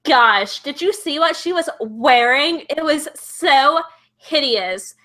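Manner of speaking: put-on drawn-out Valley Girl gossip; slow, stretched words, catty Valley Girl impression. Shown as a demonstration. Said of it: "like, kind of Valley Girl".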